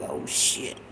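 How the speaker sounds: hushed whisper of dread. Retold or imitated. Boy realises mama knows, whispered. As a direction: breathe it, caught out. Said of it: "I'm like".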